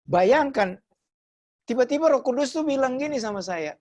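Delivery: ordinary talk, nothing marked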